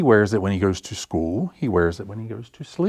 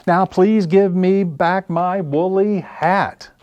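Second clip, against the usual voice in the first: high, slow, louder pleading; pleading bear; 10 semitones higher, 6 dB louder, slower than usual. Acted. The bear begs the crow for his hat, slow and high. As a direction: drag it out, begging for the hat.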